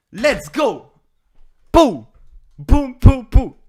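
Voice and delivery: loud high whoops; victory cheer. Real, not acted. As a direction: punch out each sound. Celebrating a won game.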